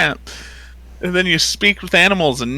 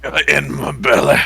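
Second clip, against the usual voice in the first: owlbear voice; 3 semitones lower than usual. Acted. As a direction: lower gruff echo of the monster line. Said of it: "the first thing the owlbear says".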